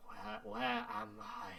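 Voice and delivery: confused spirit calling from beyond; ghostly echoing voice. Acted. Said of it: "this ghostly echoing voice".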